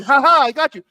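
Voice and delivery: high, loud taunting ha-ha; mock gloating. Mimicking Seer's repeated gotcha, high loud mock gloating. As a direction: crow it in mocking imitation. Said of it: "And you keep going".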